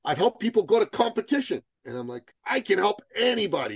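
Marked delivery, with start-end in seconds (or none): 0.0-1.6 higher-pitched, earnest mock persuasion; eager retold pleading. Eager client pleading his case. 2.5-3.8 high, enthusiastic retold boast; excited imitation. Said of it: "He was so excited to say that".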